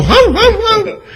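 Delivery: very high whiny mock sobbing; mocking producer crying. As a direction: squeal it like a weepy crybaby. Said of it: "he go".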